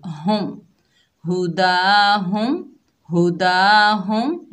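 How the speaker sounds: हम हुदा हम very slow, the m of हम said clearly, no nasal hum; clear mim. Shown as a demonstration. Teacher demonstrates izhar with हम हुदा slowly and loud. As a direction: sound हम cleanly before हुदा. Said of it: "इजहार करेंगे".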